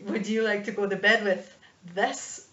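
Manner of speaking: cocky chat-up in thick mock-Scottish; swaggering Scottish dwarf; confident Scottish dwarf. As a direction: proposition boldly in a bad Scottish accent. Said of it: "he'd be all confidence".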